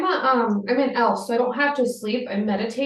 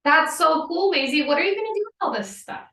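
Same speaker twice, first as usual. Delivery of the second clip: higher, eager and impressed; excited admiration; 7 semitones higher than usual. Acted. Adventurer to Maisie about her finds, excited admiration. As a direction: gush over a friend's treasures, voice lifting.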